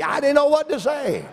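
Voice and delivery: high-pitched comic helplessness; exasperated protest. Real, not acted. Preacher admitting he had no sheep call, exasperated. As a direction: pitch it way up, at a loss.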